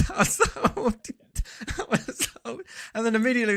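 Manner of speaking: real laughter breaking through the words; laughing. Real, not acted. Retelling his own lie to viewers, laughing. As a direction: laugh out the words, pitched well up.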